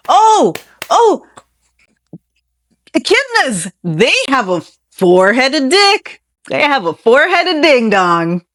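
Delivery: high, loud exclamations of amazement; shocked discovery. Real, not acted. Host discovering a bizarre echidna fact, loudly astonished. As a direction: burst out in gleeful shock, pitch jumping up.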